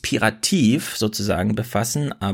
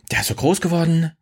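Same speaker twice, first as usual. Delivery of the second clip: imitating kindergarten teacher; 7 semitones higher than usual. Quoting kindergarten staff.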